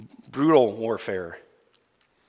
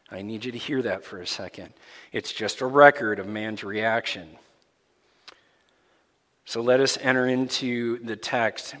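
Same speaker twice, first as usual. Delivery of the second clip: ordinary talk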